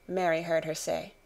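plain reading voice

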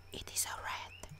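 very quiet whisper. Acted. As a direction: whisper it under your breath. Acted line amid a chase.

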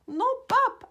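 high, loud cry of protest; shouting. A narrator's reading. Céleste tries to stop Pop taking the cushion, high shout. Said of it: "a crié Céleste".

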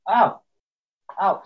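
quick high ow; sharp yelp of pain. Real, not acted. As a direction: yelp as if hit. Got hit in game.

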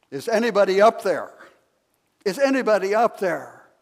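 high, loud desperate call; cry for help. Acted. Fallen jogger calling up the cliff, loud and desperate. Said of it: "began to cry for help".

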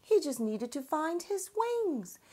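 plain reading voice

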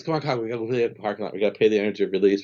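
rushed, fast urgent chatter; a nun hustling them out, very fast; hurried. Retold or imitated. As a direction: rattle it off, hurrying everyone along.

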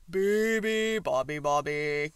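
very loud bear's chant; growling bear. A narrator's reading. Bear waking the sleeping bird, loud growl. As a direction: growl the bird's song out loud. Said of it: "growled the bear".

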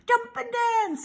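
high rooster call; high-pitched barnyard bird. A narrator's reading. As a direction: crow it out like a rooster. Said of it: "said the rooster".

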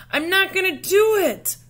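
high, loud outburst mid-race; shouting while running. Acted. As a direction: yell it out while racing a friend. Shouting while racing in playground.

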